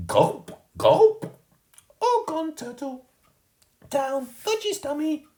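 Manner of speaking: put-on very high, slow little-kid voice; proud toddler showing off. A narrator's reading. Fudge telling Mum the turtle went down, high slow toddler voice.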